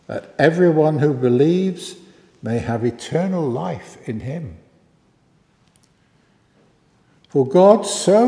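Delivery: measured, solemn Bible reading from the pulpit; scripture reading. Acted. Vicar reading the Gospel to the family service, solemn. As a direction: read the Gospel out steadily and gravely.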